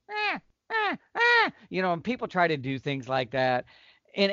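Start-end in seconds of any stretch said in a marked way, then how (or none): 0.1-1.5 very high thin squeaks; squeaky cow call. Shown as a demonstration. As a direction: squeak it out like a nervous mouse. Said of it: "instead of just going".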